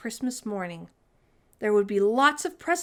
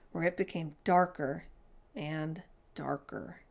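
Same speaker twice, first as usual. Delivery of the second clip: plain reading voice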